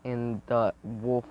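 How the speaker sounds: ordinary delivery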